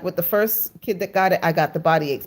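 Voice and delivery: ordinary talk, nothing marked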